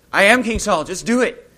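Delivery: loud, high royal command; a king barking an order at the witch; commanding shout. Retold or imitated. Saul in disguise demanding the witch obey, loud and high.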